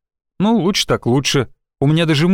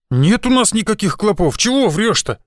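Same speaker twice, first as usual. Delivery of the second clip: indignant; 6 semitones higher than usual. A narrator's reading. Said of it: "возмутился брательник".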